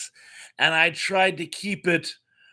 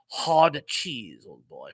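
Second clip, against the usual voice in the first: put-on Terry Thomas, English accent; 7 semitones lower, slower than usual. Host reading a viewer's go-to impression, posh English accent. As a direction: toss it off as a plummy English gent. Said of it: "My go-to English accent to, is Terry Thomas".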